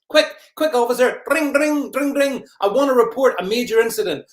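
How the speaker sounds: high, loud mock alarm; hammed-up urgent call to the police; panicked curtain twitcher. Curtain twitcher phoning police about the neighbours, mock panic. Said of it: "Who's gonna police that is curtain twitchers".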